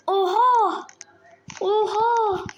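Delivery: loud, high gasping oha; amazed. Real, not acted. Gamer stunned by his own jump, amazed. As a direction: blurt it out in shock.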